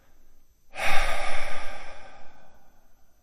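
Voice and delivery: long, loud breath out; big releasing sigh; deep exhaling sigh. Shown as a demonstration. Meditation leader showing a deep breath, long audible sigh.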